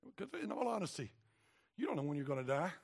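usual speaking voice

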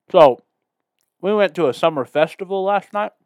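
talking with a mouth full of food. Real, not acted. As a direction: talk through a mouthful of snacks. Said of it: "I'm gonna talk with my mouth full".

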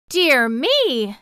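high, loud startled exclamation. A narrator's reading. Dorothy realizes where she is, exclaiming in surprise. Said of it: "exclaimed Dorothy".

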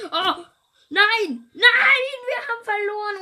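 loud, high cry of dismay; despairing shout. Real, not acted. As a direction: yell out at losing the game. Game lost, shouting.

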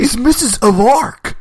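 high, loud dude voice; exaggerated high surfer voice. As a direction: announce it like a clueless bro. Quotes Bill and Ted line.

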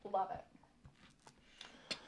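breathy whispered aside; whispering. Real, not acted. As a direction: whisper it to yourself. Quiet whispered aside after disclaimer.

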